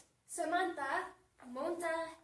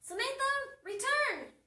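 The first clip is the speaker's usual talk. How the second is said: loud, high call to come back; acted yell; 10 semitones higher, 7 dB louder than usual. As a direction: holler it like a dad running after a car. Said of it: "So what does the dad yell?".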